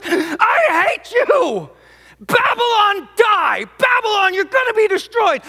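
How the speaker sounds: high, loud, hate-filled shouting; enraged outburst. Retold or imitated. The grieving psalmist curses Babylon, shouting in hatred. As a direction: let the hatred break out, yelling at Babylon. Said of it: "He snaps".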